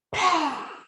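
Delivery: long high sigh of release; relieved sigh. Shown as a demonstration. Teacher demonstrating leaving the office with a relieved sigh. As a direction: let the day go in one sigh. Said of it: "I'm closing my office door".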